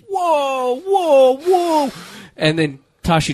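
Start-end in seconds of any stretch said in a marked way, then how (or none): 0.1-1.9 high, startled whoa repeated; mock alarmed whoa. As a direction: act out backing away in shock. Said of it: "I'm like".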